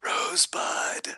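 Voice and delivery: slow breathy last word; dying whisper. Pretend.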